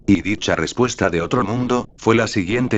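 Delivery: ordinary delivery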